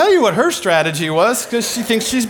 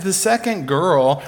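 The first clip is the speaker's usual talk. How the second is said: ordinary talk, nothing marked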